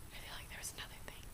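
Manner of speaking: very soft, quick whisper; whispered aside. Real, not acted. Vlogger wondering what was forgotten, whispered aside. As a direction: think aloud under your breath. Thinking aloud to self.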